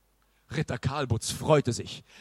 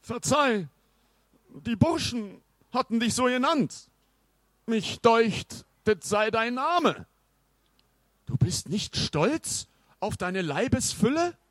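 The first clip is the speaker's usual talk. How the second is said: a touch of apology in the knight's voice; apologetic knight; 9 semitones higher than usual. Acted. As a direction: soften it into an apology. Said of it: "fuhr entschuldigend fort".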